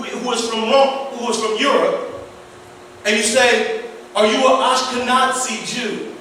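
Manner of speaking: usual speaking voice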